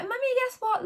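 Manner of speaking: put-on high, eager child's voice; bubbling over like a nine-year-old; excited young son. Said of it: "He was like".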